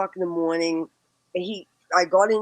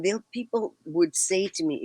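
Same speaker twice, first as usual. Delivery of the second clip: ordinary delivery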